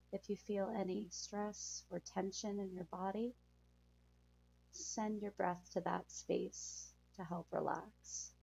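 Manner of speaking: calm soothing guide through breathing; guided meditation. Acted.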